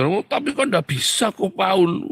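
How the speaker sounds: high, loud objection; protesting pastor. Acted. Pastor objecting to his counselor, high protest.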